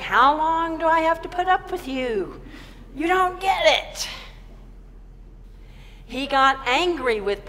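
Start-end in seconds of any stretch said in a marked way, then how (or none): 0.0-4.1 high, loud exasperated outburst; frustrated. Retold or imitated. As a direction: pitch jumps up in fed-up complaint. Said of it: "He let himself be frustrated".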